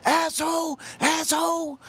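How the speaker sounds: high-pitched mock scream; retold scream. Woman heard screaming from her car, high mock yell. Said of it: "I can still hear her saying".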